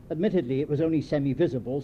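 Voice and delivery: ordinary delivery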